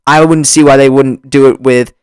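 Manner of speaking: ordinary talk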